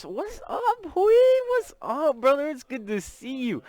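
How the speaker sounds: high, delighted hello to a friend; thrilled welcome, voice jumping up; excited greeting. Real, not acted. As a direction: greet a buddy with high-pitched enthusiasm. Greeting an arriving regular viewer.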